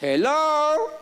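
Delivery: high, drawn-out "Hello"; pointed wake-up call. Real, not acted. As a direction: call it out as a teasing wake-up to the room. Calling audience to wake up.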